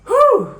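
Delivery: loud, high whew; whew of relief. Real, not acted. Vlogger recalling the tension, loud relieved whew. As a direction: let out a big relieved whew. Relief after tense story.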